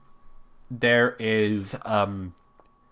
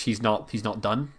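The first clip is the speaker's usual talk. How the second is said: ordinary talk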